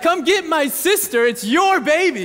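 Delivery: high, loud imitation of an angry little girl with a push on "your"; mock toddler yell. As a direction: squeal it like the cranky two-year-old. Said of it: "It's not like".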